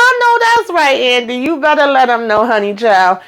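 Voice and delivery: high squeal of approval; high-pitched hype for Andy; cheering her on. Retold or imitated. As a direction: egg her on in a high, delighted voice. Cheering the character on.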